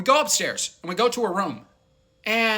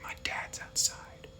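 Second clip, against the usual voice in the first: slow paranoid whisper; 17 dB quieter than usual. Retold or imitated. Quoting the paranoid friend, a slow scared whisper. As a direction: hush it like someone's listening. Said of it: "And she's like".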